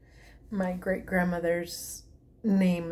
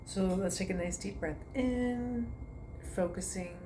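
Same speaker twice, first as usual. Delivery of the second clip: guided meditation; 5 dB quieter than usual. Acted. To her online class, soothing meditation guidance. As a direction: talk them gently from toe to foot. Leads a toe-to-head relaxation.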